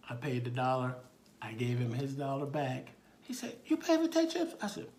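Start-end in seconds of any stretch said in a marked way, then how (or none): 3.7-4.6 put-on very high, loud child question; squeaky small-boy voice; little boy. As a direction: pipe up like an amazed small kid. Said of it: "he looked up at me".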